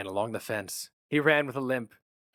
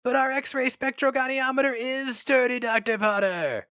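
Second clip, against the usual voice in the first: calling out; 4 dB louder than usual. A narrator's reading. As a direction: call across to him from the steps. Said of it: "called to him from the auditorium steps".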